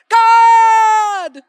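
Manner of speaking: full-throated high scream; screaming at God. Retold or imitated. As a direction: let it rip at the top of your lungs. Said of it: "scream at the top of your lungs".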